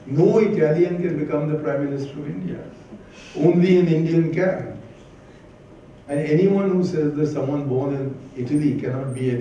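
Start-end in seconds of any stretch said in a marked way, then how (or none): 3.3-4.8 emphatic. Real, not acted. Political speech, rising emphasis.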